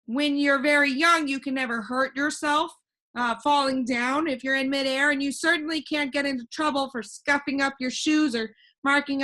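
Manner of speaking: put-on a cheerful know-it-all boy floating in the air; voiced boy Alec. Alec continues.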